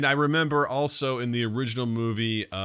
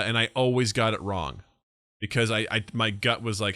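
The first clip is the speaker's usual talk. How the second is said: ordinary delivery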